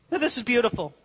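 ordinary talk

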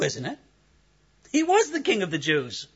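ordinary talk

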